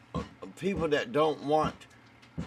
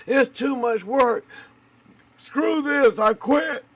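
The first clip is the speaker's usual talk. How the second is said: high, complaining mock whine; whiny quitter voice; 10 semitones higher, 4 dB louder, slower than usual. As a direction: whine like a worker giving up.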